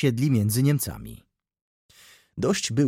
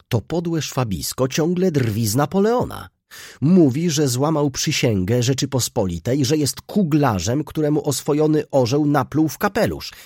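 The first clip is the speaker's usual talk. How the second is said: raised, high-pitched indignation; indignant rant; 6 semitones higher, 5 dB louder than usual. A narrator's reading. Jan complains to his friend about his brother, indignant.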